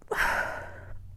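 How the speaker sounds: a long breathy sigh through tears; tearful exhale; shaky tearful sigh. Real, not acted. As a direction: let out a trembling breath while crying. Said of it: "Sorry, I'm crying".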